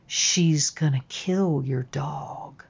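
slow, very soft voice in the ear; hushed warning. Retold or imitated. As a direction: repeat the eerie warning barely above a whisper. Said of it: "whispered in my ear".

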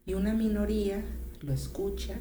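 ordinary delivery

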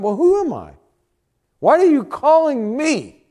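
high, loud incredulous protest; acted-out objection, loud and high; questioning God. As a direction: plead your unworthiness, voice pitched right up. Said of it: "He's questioning God".

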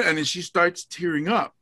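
ordinary delivery

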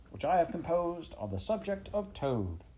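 high-pitched pompous weasel; squeaky speechmaker. A narrator's reading. Chief Weasel addressing the banquet, high and squeaky. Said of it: "began in a high, squeaky voice".

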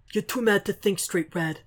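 lightly voiced tough vigilante; a hint of a rough soldier; gruff Frank voice. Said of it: "Frank's voice echoes".

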